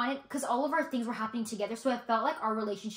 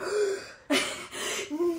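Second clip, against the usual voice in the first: sharp higher gasp of shock; mock gasp; 7 semitones higher than usual. Friend recalling pet name taken, mock shocked gasp. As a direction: gasp as if wronged. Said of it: "I was like".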